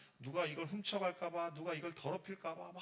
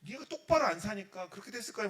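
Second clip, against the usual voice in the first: loud, higher-pitched acted rebuke; angry scolding; 5 semitones higher, 9 dB louder than usual. Preacher voices God blaming bad living, loud angry scolding. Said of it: "화를 냈을 텐데".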